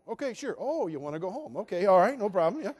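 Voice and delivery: high, swooping, too-eager agreement; overdone agreeableness. Retold or imitated. Rejected suitor agreeing to take her home, overdoing it. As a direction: act far too fine with it.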